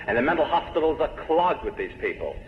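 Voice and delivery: ordinary talk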